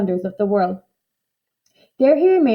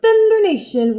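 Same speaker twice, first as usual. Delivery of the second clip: big roaring exclamation, higher and louder; 10 semitones higher, 5 dB louder than usual. A narrator's reading. Showman roaring at the sight of the beast. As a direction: bellow it out in amazement. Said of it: "roared C J".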